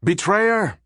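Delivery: high shrill-pitched cry of betrayal; the aide shrieks at the captain who betrayed them, accusing shout; shrieking accusation. A narrator's reading.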